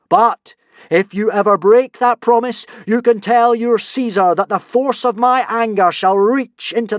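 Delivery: slow, loud, high god decree; booming god voice. Acted. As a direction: threaten like an angry god. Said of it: "made his final speech".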